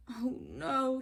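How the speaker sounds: high groan of frustration; a tired bear who has had enough; exasperated Mister Bear. Acted. Mister Bear fed up again.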